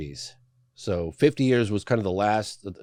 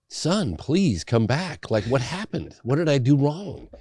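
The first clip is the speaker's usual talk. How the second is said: higher, slow pleading as the father; begging father; 7 semitones higher, slower than usual. Retold or imitated. Retelling father begging son to return, imitated pleading. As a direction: imitate dad begging his son to come back. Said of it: "And he was begging me".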